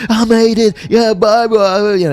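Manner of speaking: high, loud shout of triumph; joyful scream. Retold or imitated. Inmate told of his parole, screaming his joy in the chow hall. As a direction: yell it out like you just won your freedom. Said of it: "And I come screaming out there in the chow".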